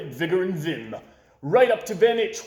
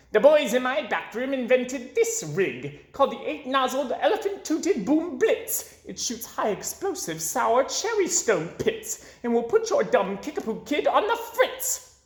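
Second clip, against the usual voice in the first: gloating villain; 9 semitones higher than usual. A narrator's reading. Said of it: "the old pig".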